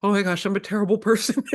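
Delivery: quick, hammed-up wail of guilt at herself; mock self-despair. Teacher recalling her guilt after making a child cry, mock despair. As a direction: overplay the horror at your own mistake. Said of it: "I was like".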